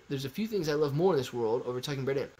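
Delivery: fast, fluent reread of the line; brisk confident reading; quick fluent reread. Real, not acted. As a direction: rattle it off now that it makes sense.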